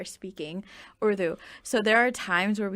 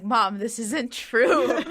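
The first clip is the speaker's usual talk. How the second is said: mock exasperation; 3 semitones higher, 8 dB louder, much slower than usual. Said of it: "and I'm like".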